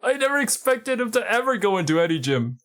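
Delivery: high-pitched, hammed-up shock; mock disbelief. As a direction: gasp it out in feigned, high-voiced amazement. Mock shock at gym visit.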